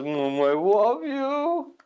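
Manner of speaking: high, slow lovey-dovey coo; doting farmer. Acted. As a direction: coo at a beloved pet. Farmer kisses frog.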